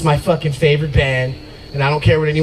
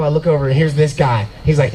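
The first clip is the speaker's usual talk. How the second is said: ordinary talk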